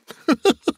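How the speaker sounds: high, gleeful chuckle; short bubbling cackle of glee; bursting laugh. A narrator's reading. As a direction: break into a high delighted laugh. Scrooge bursts out before announcing raise.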